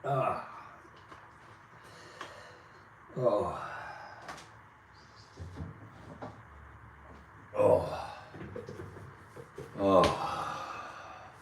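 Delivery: pained groans and sighs; hurting, catching breath; with heartburn. Real, not acted. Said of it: "hold on a second".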